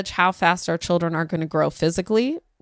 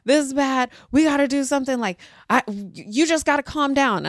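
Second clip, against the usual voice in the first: higher-pitched, anxious parent voice; mild panicked pleading; freaking out; 5 semitones higher than usual. Retold or imitated. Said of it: "so I'm freaking out".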